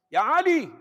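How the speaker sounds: high, loud call to a son; fond calling out. Acted. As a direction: call him from across the yard. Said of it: "manggilnya enak-enak".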